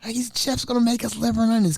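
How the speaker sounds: high, fast, excited chatter; excited residents. Pretend. Residents he imagines getting excited, high and fast. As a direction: cheer it up like thrilled residents. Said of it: "I wanna get them all excited".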